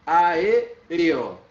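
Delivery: Aéreo with A and E split apart, loud; teacher separating vowels in Aéreo; separated vowels. Shown as a demonstration. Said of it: "A, E".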